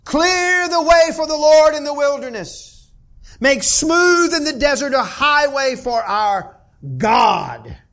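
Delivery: loud, high prophetic cry across the wilderness; calling out. Acted. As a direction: proclaim it like a voice calling in the desert. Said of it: "A voice is calling".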